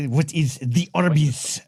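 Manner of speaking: slow, badly faked German accent. Host mimicking German tourists asking about Arby's, bad German accent. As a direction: drag it out in a clumsy German accent. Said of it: "worst German accent I've ever done".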